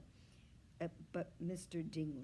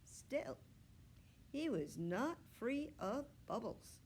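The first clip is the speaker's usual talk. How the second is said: ordinary delivery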